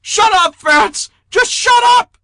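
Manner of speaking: shrieking high and very loud; high, manic shout. A narrator's reading. Jeter losing it at Fats, high manic shriek. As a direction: lose it completely, voice shooting up. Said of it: "his voice high and manic".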